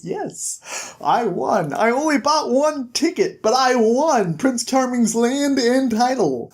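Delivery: high, smug triumph; gloating win. Retold or imitated. Jack gloats to Bigby by the pool, high and smug. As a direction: crow about winning the lottery.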